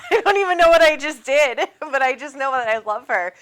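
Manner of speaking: loud, bursting laughter through the words; laughing. Real, not acted. Host tells viewers how overwhelmed she is, laughing. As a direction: laugh it out in happy disbelief.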